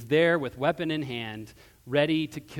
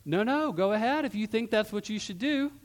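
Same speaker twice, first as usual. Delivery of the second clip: high-pitched, mock-permissive dare; daring; 7 semitones higher than usual. The Lord again dares Balaam to go, in raised pitch. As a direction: dare them to go right ahead. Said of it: "the Lord again dares him".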